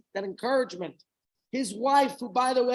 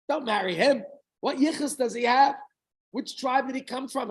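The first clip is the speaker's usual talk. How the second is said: disapproving father; 6 semitones higher, 6 dB louder than usual. Retold or imitated.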